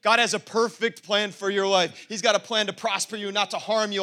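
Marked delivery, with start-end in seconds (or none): none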